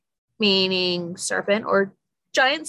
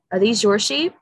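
ordinary talk

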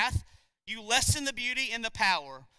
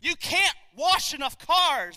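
forceful preaching; 9 semitones higher, 8 dB louder, slower than usual. Real, not acted.

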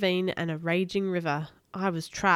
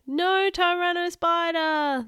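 long, high drawn-out cry; booming chopper voice; 10 semitones higher, 4 dB louder, slower than usual. A narrator's reading. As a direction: boom it out slowly from the helicopter, pitched high. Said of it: "the voice from the chopper boomed".

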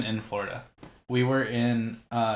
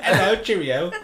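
high, loud, laughing fake London accent; mock London cheerio; hammed-up London accent through laughter; 7 semitones higher, 9 dB louder than usual. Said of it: "You talk like you're from London".

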